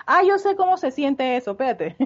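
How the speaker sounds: agudo y fuerte, muy animado; entusiasta. Acted. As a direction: como una amiga chismosa que se entusiasma. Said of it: "Y dice". (voz entusiasta y aguda)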